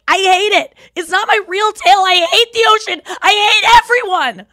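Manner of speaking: high, loud whiny tantrum. Retold or imitated. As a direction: scream it like a furious spoiled kid. Dolphin tantrum acted out.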